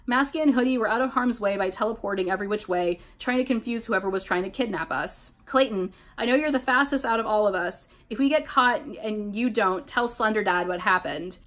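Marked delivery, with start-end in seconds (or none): none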